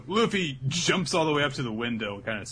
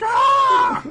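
hammed-up shriek; mock high-pitched outburst; loud high scream; 15 dB louder than usual. Sanji's acted outburst.

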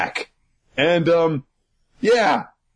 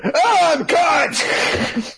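hammed-up panic; 12 semitones higher, much slower than usual. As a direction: yelp like you've been caught. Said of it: "Five minutes later".

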